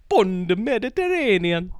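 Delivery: a hint of a high Rasta accent; lightly voiced Italian Rasta patter. Friend riffing on the pastafarian, light Rasta accent. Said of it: "do the accent".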